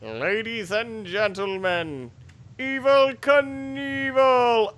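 slow, building showman's announcement; mock announcer. Introducing a game stunt, mock announcer shout.

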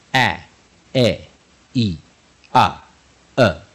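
short A E I O U sounds, each separate; short vowels. Shown as a demonstration. Teacher sounding out short vowels for learners, crisp phonics demo. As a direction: sound out five short vowels, pausing between. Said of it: "短母音就是".

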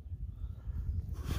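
soft, breathed-out sigh; wordless sigh at the view; long sigh. Real, not acted. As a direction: let out a long, quiet breath. Taking in the view.

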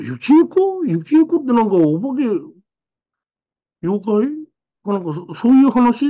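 高く上ずった驚きの問い; high, startled, curious child; surprised. Retold or imitated. Child self asks about yokai. (友達に妖怪かと聞く、高く驚いた声)

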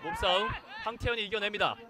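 ordinary talk

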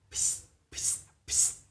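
ps hissed unvoiced, almost silent; whispered final sound. Shown as a demonstration. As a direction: breathe out the ending ps. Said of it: "Bạn để ý nha".

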